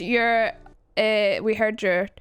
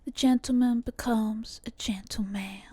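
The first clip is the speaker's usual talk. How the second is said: put-on Southern accent; 3 dB quieter than usual. Copying the recorded Southern speaker.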